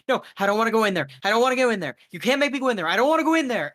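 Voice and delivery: high, loud, rushed panicked pleading; a terrified Becca refusing to enter the cold room; freaking out. Acted. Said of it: "is freaking out".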